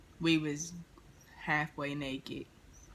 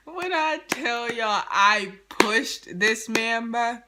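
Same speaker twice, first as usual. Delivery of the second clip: emphatic exclaiming; 6 semitones higher, 7 dB louder, slower than usual. Real, not acted. Telling the shocking push.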